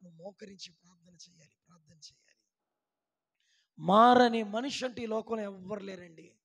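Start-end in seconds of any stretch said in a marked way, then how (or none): 0.0-2.4 hushed plea. Acted. Pastor urging the congregation to kneel, soft and low. Sudden soft after shouting.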